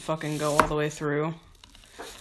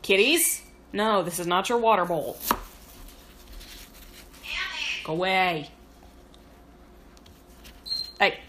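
calling out to cats; 3 semitones higher than usual. Real, not acted. Shooing cats from water bowl.

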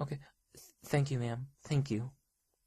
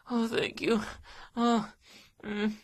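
usual speaking voice

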